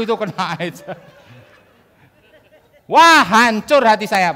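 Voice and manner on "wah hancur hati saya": slow, loud heartbroken cry-out. Retold or imitated. Telling the audience his heart broke, slow and loud. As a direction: let the heartbreak spill over. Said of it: "Nangis saya langsungan".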